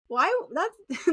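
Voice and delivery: ordinary delivery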